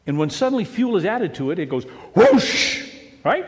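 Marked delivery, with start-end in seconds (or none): 1.6-2.9 slow loud whoosh of flame catching. Retold or imitated. Pastor acting out a gas flame, loud whoosh. Pilot light flaring up.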